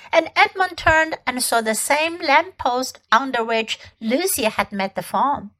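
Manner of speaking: ordinary delivery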